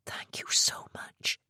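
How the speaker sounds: slow, voiceless thanks; whisper. Acted. Abby whispering thanks to Mister Anand, slow and voiceless. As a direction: breathe the thanks out, overwhelmed.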